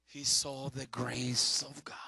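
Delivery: slow reverent whisper. Acted. Pastor revealing grace to the congregation, whispered. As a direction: breathe it like a secret.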